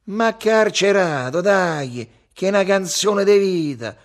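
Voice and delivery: loud, high urging; insistent plea. A narrator's reading. Lello argues for his song, insistent. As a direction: push hard for it.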